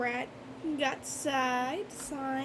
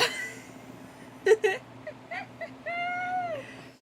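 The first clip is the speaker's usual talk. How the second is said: high squealing laugh. Real, not acted. Breaks up laughing mid-word.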